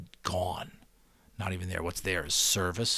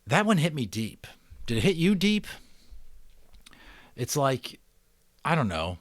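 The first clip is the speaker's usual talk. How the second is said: moved, animated; 6 semitones higher than usual. Real, not acted. Reader moved after chapter.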